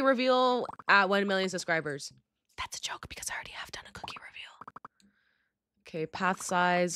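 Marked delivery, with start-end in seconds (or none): 2.6-4.3 fast whispered aside. Real, not acted. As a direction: whisper it quickly to the camera.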